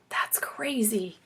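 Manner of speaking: slow, breathy whisper of disbelief. A narrator's reading. Narrator boy whispering that it's crazy, slow whisper. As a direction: whisper it slowly, stunned.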